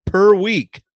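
PER WEEK loud and high, punched word by word; emphatic reveal. Real, not acted. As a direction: land "per week" like a staggering figure. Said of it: "I repeat".

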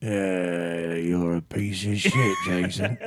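drawn-out mock English insult; playfully nasty old English voice; mean English accent. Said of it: "Now say something mean".

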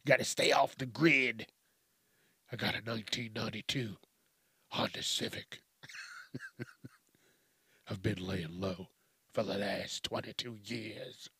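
low, hushed film-hero narration; mock-dramatic quiet movie monologue; movie opening voice-over. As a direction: play a lone survivor opening a movie, low and soft. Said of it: "That's how the movies are gonna start".

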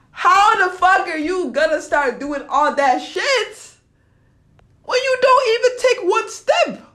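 mad rant climbing to a high-pitched yell; angry yelling. Real, not acted. Speaker yelling at themself over inaction, angry. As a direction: yell at yourself, pitch rising sharply. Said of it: "I'm yelling at myself".

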